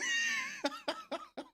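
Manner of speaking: squealing burst of laughter; helpless high-pitched cackle; high shrieking laugh. Real, not acted. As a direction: let a very high laugh escape. Cracking up at friend.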